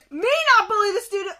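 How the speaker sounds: loud, high, fast disbelief; outraged echo. Real, not acted. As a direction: throw the words back indignantly, rushing. Outraged echo of what was read.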